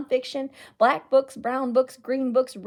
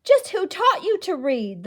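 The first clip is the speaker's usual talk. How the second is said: higher, louder prying mayor voice; suspicious mayor; 8 semitones higher, 4 dB louder than usual. Acted. Mayor drawing closer to Eli, prying and demanding. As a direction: lean in and demand an answer.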